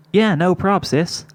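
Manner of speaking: light, high offhand filly voice; casually brushing off her sister; nonchalant. Acted. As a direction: toss it off like it's nothing. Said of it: "Sweetie Belle said nonchalantly".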